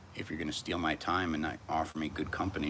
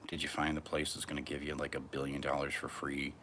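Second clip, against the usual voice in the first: usual speaking voice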